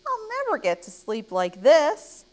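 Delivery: high, loud complaint; storybook child at wit's end; exasperated cry. Acted. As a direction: wail it out in frustration. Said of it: "cried Ava".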